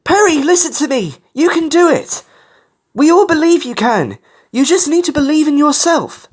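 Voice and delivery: high, loud, impassioned pep talk; urgent rallying yell; encouraging shout. A narrator's reading. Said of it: "shouted out to encourage him".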